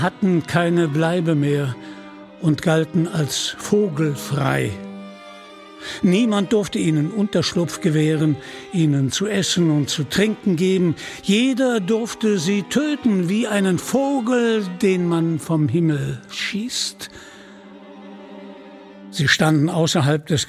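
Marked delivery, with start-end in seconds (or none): none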